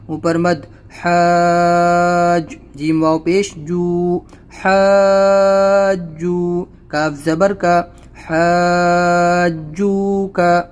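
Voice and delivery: حاجو کا read slowly, the alif held long; slow madd recitation. Shown as a demonstration. Teacher spelling and stretching the madd for pupils. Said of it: "الف کے اوپر مد ہے".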